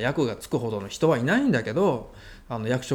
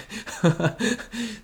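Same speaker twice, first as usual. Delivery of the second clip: laugh; 7 semitones higher than usual. Real, not acted. Laughs at failed improvement.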